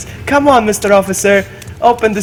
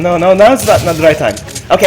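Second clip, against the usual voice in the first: ordinary talk, nothing marked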